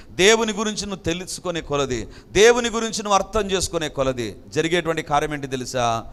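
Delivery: ordinary talk, nothing marked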